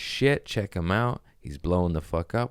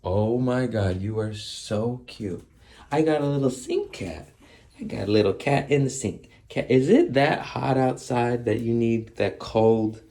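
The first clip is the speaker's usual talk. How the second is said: cooing at a cat; 6 semitones higher than usual. Real, not acted.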